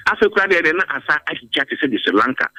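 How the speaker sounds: ordinary talk, nothing marked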